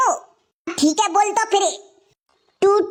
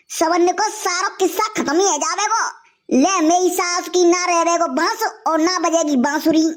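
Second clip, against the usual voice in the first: usual speaking voice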